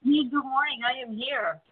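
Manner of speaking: echoey, far-off phone line; big hall echo. Real, not acted. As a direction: sounds like a big empty room. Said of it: "You sound a little echoey".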